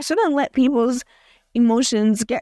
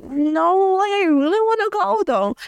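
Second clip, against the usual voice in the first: pleading; 11 semitones higher than usual. Retold or imitated. Her own retold plea to dad.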